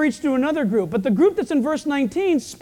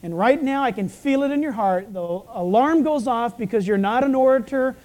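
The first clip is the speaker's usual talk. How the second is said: usual speaking voice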